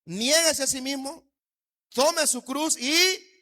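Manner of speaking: each word drawn out very slowly and given weight; slow solemn quote. Acted. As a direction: let the scripture sink in word by word. Preacher quoting Jesus with weight.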